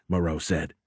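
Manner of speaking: usual speaking voice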